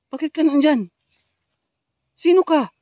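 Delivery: loud startled shouts; alarmed shouting. Real, not acted. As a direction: shout at the figure you just spotted. Spots girl again, shouts.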